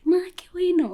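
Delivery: high whispery little voice; thin, wistful congratulation. Retold or imitated. Deported girl congratulates her friend, high whispery voice. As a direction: imitate her being glad for you, weakly. Acts the sad girl's reply.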